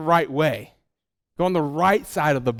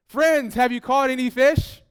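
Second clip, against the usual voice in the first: calling out as Jesus; 7 semitones higher than usual. Acted. Jesus calls to disciples from shore.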